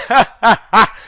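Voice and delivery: short put-on laugh; hammed-up villain laugh; mock laugh. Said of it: "said the blood".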